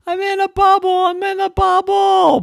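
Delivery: very high loud call from the sky; Chloe calling. Acted. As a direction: cry out from far above. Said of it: "And she said".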